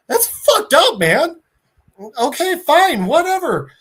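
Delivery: high, loud exasperated whine; acted-out outraged giving in; indignant protest. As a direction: object loudly, then cave in with annoyance. Hero protests threat, gives in.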